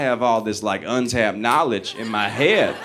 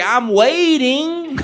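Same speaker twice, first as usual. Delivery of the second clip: put-on much higher, slow drunken taunt; cocky dare; 12 semitones higher, much slower than usual. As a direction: drawl it like a fearless drunk hero. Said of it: "I was like".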